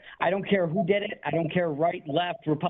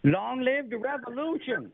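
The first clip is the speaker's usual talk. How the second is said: slow, high rallying cry; fiery revolutionary speech; 7 semitones higher, slower than usual. Acted. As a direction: proclaim it like a rebel leader. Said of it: "Are you Davy Crockett now?".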